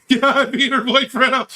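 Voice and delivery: high, giggly delivery; gleeful laughing repeat; laughing boast. Real, not acted. Repeats his boast while laughing.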